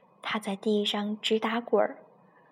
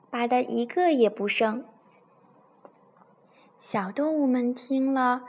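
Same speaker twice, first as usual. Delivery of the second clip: put-on storytelling voice for a child; story narration; 4 dB louder than usual. A narrator's reading. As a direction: read it like a bedtime story to a little one. Mother reads bedtime story. (给孩子讲故事的语气)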